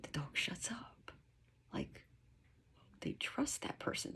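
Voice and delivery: very soft, as if all has gone quiet; hushed. Shown as a demonstration. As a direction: drop to a near whisper. Imitating the dog falling silent.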